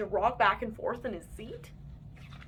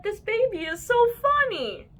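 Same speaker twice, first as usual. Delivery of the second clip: doting grandparents; 11 semitones higher, 7 dB louder than usual. A narrator's reading. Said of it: "They said".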